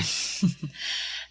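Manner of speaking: a quiet breathy little laugh; soft chuckle. Acted. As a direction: chuckle fondly, under your breath. Mother chuckles at son's offer. (mahinang tawa)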